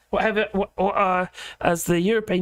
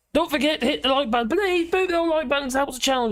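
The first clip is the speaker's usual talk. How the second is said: channel plug; 7 semitones higher, faster than usual. Real, not acted. Host pleads for likes.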